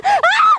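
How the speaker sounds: sudden piercing high shriek; scream of terror. Acted. Ghost hunter shrieks in terror when the creature attacks, piercing high scream.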